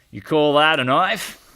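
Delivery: mock the small knife, Aussie style; hammed-up Crocodile Dundee Australian accent; Mick impression. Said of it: "Remember Mick from Crocodile Dundee?".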